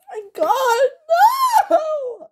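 very high wailing shout; crying out in hammed-up despair; anguished cry. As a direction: wail it out in shock and despair. Husband hears of premature baby.

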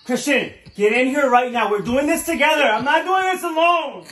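loud, high demanding call; demanding shout. Acted. Girlfriend shouting for boyfriend to help pick outfit. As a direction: yell for him to come help.

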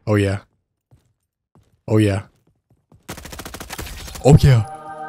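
usual speaking voice

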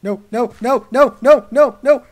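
rapid string of high, very loud cries; panicked screaming. Real, not acted. Player fleeing an attack, screaming in panic. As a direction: shriek it over and over.

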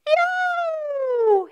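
high pained yelp; fox yelp. Acted. Storyteller yelping as the fox is butted.

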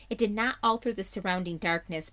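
plain reading voice

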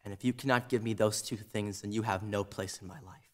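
very low, hushed and measured; quiet ultimatum. Acted. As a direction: lay down terms softly, with long pauses.

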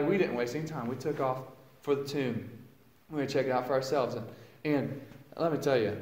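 usual speaking voice

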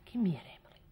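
put-on secretive whisper to the girl. Creepy woman whispering to Emily to come closer. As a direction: lure her close in a whisper.

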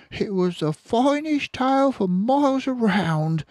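high, loud motherly fox voice; Missus Fox, high voice. Acted. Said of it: "she said between lick".

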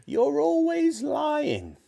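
yelling. A narrator's reading. Grandma accusing George of lying, yelling. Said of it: "Grandma yelled".

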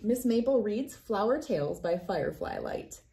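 ordinary delivery

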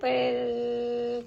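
low, soft rolling brrr; long, steady lip-buzz motor noise; imitated motor whirr. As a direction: make the noise of a little machine running. Imitates blender while showing toy button.

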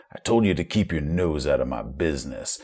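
low, quiet growl of a threat; Murphy growling. Acted. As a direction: growl it low and menacing, holding the volume down. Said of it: "Murphy spits out his toothpick and growls".

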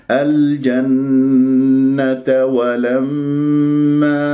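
الجنة with a long nasal hum, no stress; ghunna without stress. Shown as a demonstration. Teacher showing ghunna to students, slow. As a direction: hum the nun of الجنة smoothly, unstressed. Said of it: "من غير نبر".

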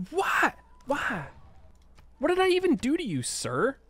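very high, incredulous squeal; high-pitched comic indignation; mock-outraged protest. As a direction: plead your case in a squeaky, put-upon pitch. Protesting to game opponent.

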